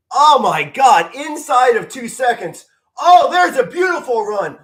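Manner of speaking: high, loud, stretched shout of delight; excited outburst. Real, not acted. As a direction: erupt at a great play, voice cracking high. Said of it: "my word just went all over the place".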